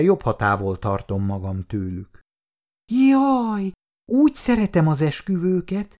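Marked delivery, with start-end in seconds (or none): 2.9-6.0 put-on high, gushing child's voice; delighted little girl. A narrator's reading. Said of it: "csapta össze a kezét Olenka". (lelkendező kislányos hang)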